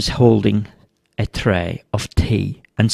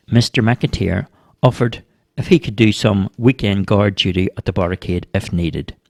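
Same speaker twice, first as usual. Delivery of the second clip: ordinary delivery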